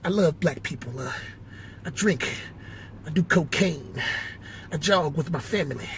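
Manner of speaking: soft, measured presidential address; George W impression. President telling people he loves them, soft George W. voice. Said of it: "hear more George W".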